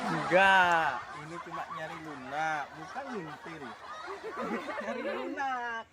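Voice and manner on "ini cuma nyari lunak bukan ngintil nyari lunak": giggling through the words; laughing while talking. Real, not acted. Teased, laughs it off.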